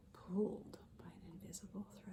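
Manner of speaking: hushed whisper of the phrase; whispered repeat. Shown as a demonstration. Teacher repeating the phrase to children in a whisper. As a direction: breathe "Pulled by an invisible thread" in a soft whisper. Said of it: "repeats line softly".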